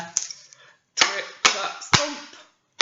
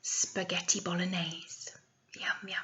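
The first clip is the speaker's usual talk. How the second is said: soft savouring whisper, much softer; 4 semitones lower, 12 dB quieter than usual. Real, not acted. Teacher telling children her favourite food, whispered. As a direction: whisper it like a treat.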